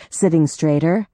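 usual speaking voice

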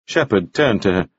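ordinary delivery

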